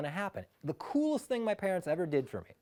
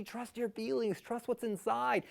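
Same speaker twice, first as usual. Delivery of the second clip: soft mystical voice; 4 semitones higher, 6 dB quieter than usual. Retold or imitated. Obi-Wan's voice guiding Luke.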